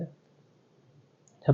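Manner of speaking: barely voiced breathy "अच्छा"; whispered aside. Real, not acted. Teacher whispering to himself in class, hushed. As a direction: drop to a near silent whisper.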